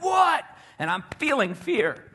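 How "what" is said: high loud what; angry driver shouting through the window. Retold or imitated. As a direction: snap it out, startled and annoyed. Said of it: "the guy's like".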